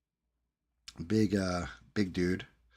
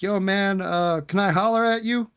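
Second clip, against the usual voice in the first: much higher mock voice of the moocher; imitating the guy; 11 semitones higher, 4 dB louder than usual. Mimicking the guy at the driveway, much higher voice. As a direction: lightly parody his casual ask. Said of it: "He's like".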